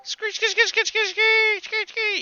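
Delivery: very high-pitched rapid rat squeals; rat screeching. Acted. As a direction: screech like a pack of rats. Game master imitates the rats screeching.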